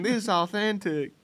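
high, mocking Southern accent. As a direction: boast in a put-on Southern accent. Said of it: "I don't know why we do Southern accents".

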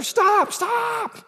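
very high, loud "stop, stop"; urgent stop. Shown as a demonstration. Pastor shows emphatic repetition with a shouted stop. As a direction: yell it to halt someone now. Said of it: "you know, or".

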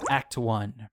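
ordinary delivery

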